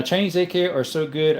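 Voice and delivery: ordinary talk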